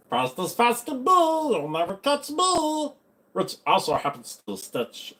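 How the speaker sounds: high, slow put-on cartoon voice; cartoon impression. Shown as a demonstration. Impressionist trying a cartoon line, high and slow. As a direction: draw the words out way up high.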